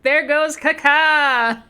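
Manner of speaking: high, loud, slow announcer call; playful mock commentator drawing out the name; soccer announcer. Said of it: "the announcer would be".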